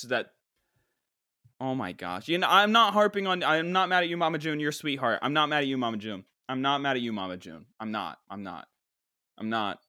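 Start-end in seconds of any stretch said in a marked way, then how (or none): none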